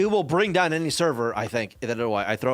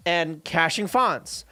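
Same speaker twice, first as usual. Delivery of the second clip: usual speaking voice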